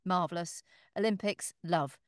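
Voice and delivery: normal talking voice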